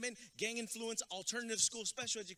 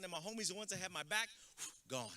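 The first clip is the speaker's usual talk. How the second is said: ordinary talk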